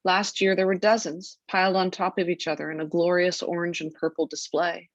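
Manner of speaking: ordinary talk, nothing marked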